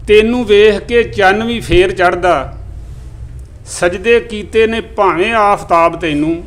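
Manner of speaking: measured, even recital of verse; poem recitation. Acted. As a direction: recite each line like a mushaira couplet. Recites Punjabi poem to audience.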